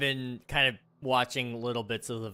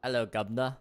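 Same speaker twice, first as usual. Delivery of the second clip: stock Cockney British greeting; British accent; faster than usual. Pretend. Streamer attempting the British accent for chat, mock British. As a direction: try out a cheeky British accent. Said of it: "I need to do the British accent".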